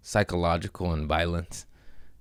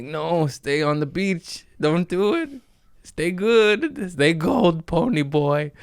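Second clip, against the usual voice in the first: much higher, slow, earnest mock plea; high pleading inner voice; 10 semitones higher, slower than usual. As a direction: plead with yourself in a squeaky high voice. Inner voice urging him stay good.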